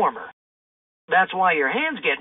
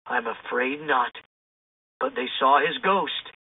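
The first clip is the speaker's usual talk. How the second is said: fearful mayor; 5 semitones lower than usual. A narrator's reading. Mayor telling the kids about the ghost, hushed dread. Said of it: "The mayor shivered".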